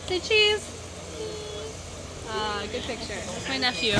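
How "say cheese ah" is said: usual speaking voice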